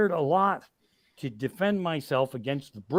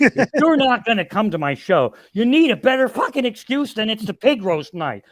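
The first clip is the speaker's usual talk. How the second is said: louder, higher hammed-up rant at fans; mock furious outburst; 6 semitones higher, 6 dB louder than usual. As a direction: blow up at the no-show fans, half in jest. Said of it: "I fucking launched into outer space".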